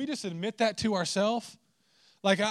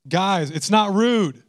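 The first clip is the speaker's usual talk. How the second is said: slow and louder, punch not in it's not rude; emphatic repeat; 2 semitones higher, 8 dB louder, slower than usual. Shown as a demonstration.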